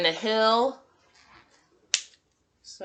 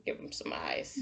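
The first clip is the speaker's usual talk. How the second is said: whisper; 11 dB quieter than usual. Real, not acted. Teacher drawing a cow face whispers to herself, soft whisper. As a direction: drop to a soft breathy-free whisper.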